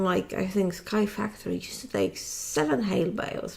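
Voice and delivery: ordinary talk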